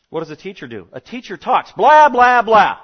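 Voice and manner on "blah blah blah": loud, high blah-blah-blah; blaring mock yammering; mock chatter. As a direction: burst out high and loud, mocking nonstop talk. Mocking a teacher's constant talk.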